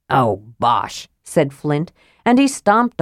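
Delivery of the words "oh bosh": low dwarf grumble; gruff dismissal. A narrator's reading. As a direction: scoff it away, deep in the voice. Dwarf dismisses it in high dudgeon.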